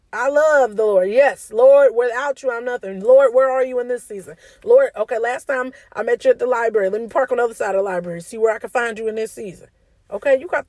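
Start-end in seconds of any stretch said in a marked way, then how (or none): 0.1-5.2 loud, pleading cry to the Lord; fervent prayer. Acted. Preacher crying out to the Lord in fervent prayer. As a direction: call out to God with fervour.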